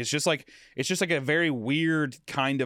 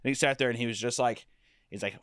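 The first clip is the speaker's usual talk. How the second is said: usual speaking voice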